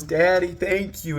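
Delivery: put-on high, slow little-boy voice; grateful child. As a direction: imitate a small child thanking a parent. Grateful son voiced by father.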